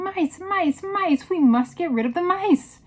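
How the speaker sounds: very high, loud yell; panicked yelling. A narrator's reading. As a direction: yell like a whole crowd in alarm. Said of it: "everybody was yelling".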